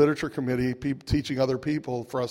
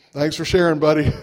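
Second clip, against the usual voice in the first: louder, mock-polite dismissal; sarcastic brush-off; 2 semitones higher, 8 dB louder than usual. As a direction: shut him down with fake thanks. Brushing off the sponsor's lecture.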